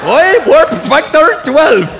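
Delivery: slow, high, loud mock-Scottish shout; Scotty. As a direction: stretch it out in broad fake Scottish. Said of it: "Scotty in Star Trek was the only one".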